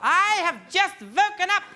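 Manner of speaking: loud, high, hammed-up German accent; shouted mock-German demands. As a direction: bellow slow commands in a German accent. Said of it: "How Germanic is that?".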